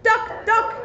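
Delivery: high excited yell of alarm. Acted. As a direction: shout the warning up high. Character yells warning in story.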